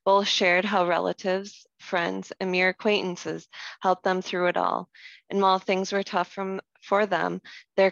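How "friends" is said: ordinary talk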